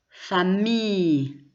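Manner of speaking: Famille slowly, ending ee-y with no L; silent double L. Shown as a demonstration. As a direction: stretch Famille out to a long gliding ee-y. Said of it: "on ne prononce pas le double L". (« Famille » lentement, sans prononcer le double L)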